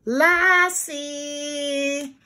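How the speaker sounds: high, long drawn-out call. A narrator's reading. Timmy calls his sleeping dog, calling out. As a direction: stretch the name as if calling from afar. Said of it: "she heard Timmy call".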